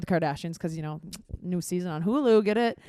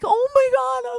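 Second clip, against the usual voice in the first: much higher squeaky freakout; retold panic; 5 dB louder, slower than usual. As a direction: shriek it at the mouse. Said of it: "and I'm like".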